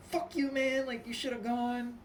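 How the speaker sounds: high, loud road-rage retort; out-of-control anger. Acted. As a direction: act out a driver blowing up. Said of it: "you're out of control".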